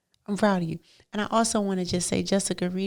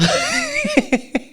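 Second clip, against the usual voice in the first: sudden high-pitched laugh; 5 dB louder than usual. Real, not acted. Preacher bursting into a high laugh.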